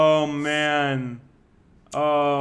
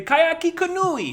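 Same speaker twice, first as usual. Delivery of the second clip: high, slow, drawn-out friend impression; imitating a friend; 14 semitones higher, 4 dB louder, slower than usual. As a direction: mimic the way he says it. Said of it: "What, what he would say".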